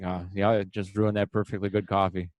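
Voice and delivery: low gruff dismissive voice; imitating disapproving workers; gruff blue-collar guy. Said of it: "'cause it's like".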